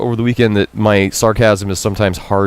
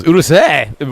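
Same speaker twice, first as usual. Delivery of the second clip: rude snap; 7 semitones higher, slower than usual. Acted. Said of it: "very rude".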